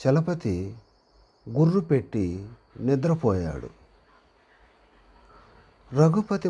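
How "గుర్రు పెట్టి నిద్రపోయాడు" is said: usual speaking voice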